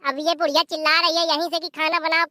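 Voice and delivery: usual speaking voice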